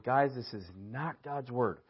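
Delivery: a pastor's quiet heartfelt caution; concerned, from the heart. Acted. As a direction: plead gently with the flock. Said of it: "out of the concern of the heart".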